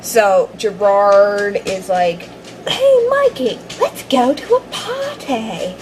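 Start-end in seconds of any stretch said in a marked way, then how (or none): 2.7-5.7 put-on British accent. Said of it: "Not in a British accent".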